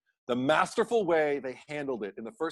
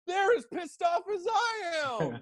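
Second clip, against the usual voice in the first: mock outrage. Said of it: "And I'm like".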